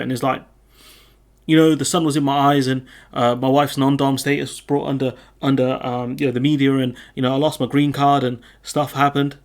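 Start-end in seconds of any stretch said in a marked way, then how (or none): 1.5-9.4 louder, flustered, rambling excuses in a mock politician voice; mock Sunak excuses. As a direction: ham up a politician fumbling for excuses.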